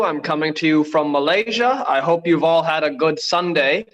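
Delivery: normal talking voice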